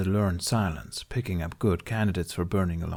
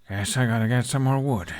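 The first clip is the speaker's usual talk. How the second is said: sighing; 3 semitones higher than usual. A narrator's reading. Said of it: "he sighed".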